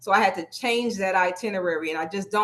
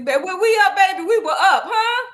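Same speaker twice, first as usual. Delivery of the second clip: high, playful ribbing of a late-night buddy; bubbly, much higher, like sharing a joke; playful teasing; 10 semitones higher, 7 dB louder than usual. Real, not acted. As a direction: tease her about staying up so late.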